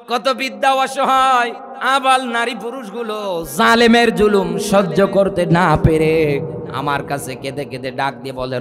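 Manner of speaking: ordinary talk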